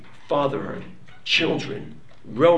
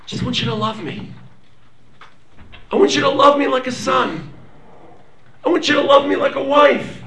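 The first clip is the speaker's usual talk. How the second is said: passionate plea to God; 10 semitones higher, 5 dB louder than usual. Acted. The people telling God they only want love, passionate plea. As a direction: beg to be loved, not owed. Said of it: "we tell HaKadosh Baruch Hu".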